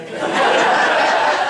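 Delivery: loud breathy laugh; burst of laughter. Real, not acted. Woman laughing after her comeback, loud laugh. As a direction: crack up at her own comeback.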